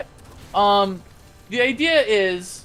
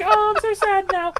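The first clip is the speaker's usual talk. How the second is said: much higher, hammed-up sadness; mock sad daughter; 11 semitones higher than usual. Daughter announcing her sadness, high and hammed-up. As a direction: play the sad girl for laughs. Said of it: "she's like".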